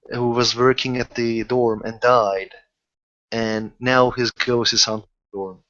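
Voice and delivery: normal talking voice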